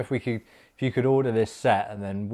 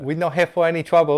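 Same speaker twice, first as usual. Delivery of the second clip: higher, louder, hammed-up Chinese accent; mock Chinese accent; 6 semitones higher, 6 dB louder than usual. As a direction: tease with a cheeky Chinese accent impression. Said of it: "No, don't do that".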